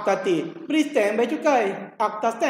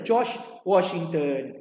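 slower and lower, drawing out George Washington; slow emphatic answer; 6 semitones lower, much slower than usual. Shown as a demonstration. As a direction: press the answer into memory. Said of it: "jawab soalan sendiri". (jawapan perlahan dan lebih rendah)

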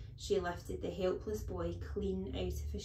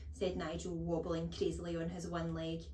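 plain reading voice

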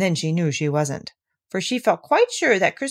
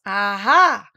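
louder, not-very-good "Aha!"; shaken attempt; 2 semitones higher, 6 dB louder than usual. A narrator's reading. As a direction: try a triumphant aha after a fright. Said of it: "as well as he could after his terrifying journey".

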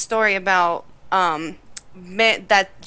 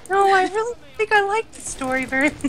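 much higher mock voice; imitating boyfriend; 9 semitones higher than usual. Boyfriend reacting to the story, higher mimicking voice. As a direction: quote him in a pitched-up put-on voice. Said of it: "he's like".

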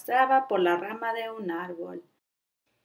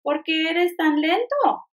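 higher, louder teasing monkey; howler monkey voice; 6 semitones higher, 4 dB louder than usual. Acted. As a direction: call it out like a cheeky monkey. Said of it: "preguntó un día el mono aullador".

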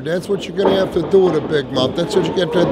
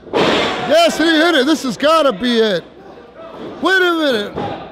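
excited; 7 semitones higher than usual. Real, not acted. Big move, surprise result.

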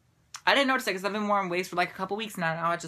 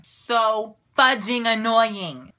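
slow emphasis; much slower than usual. Real, not acted. Self-censors the swear.

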